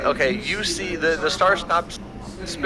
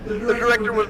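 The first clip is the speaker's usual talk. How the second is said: ordinary talk, nothing marked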